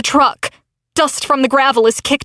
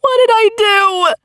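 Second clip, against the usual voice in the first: even higher breaking cry; anguished sob; 10 semitones higher than usual. A narrator's reading. Narrator repeats in anguish over Veronica, sobbing cry. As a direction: let the sob take the voice. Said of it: "I burst into an anguished sob".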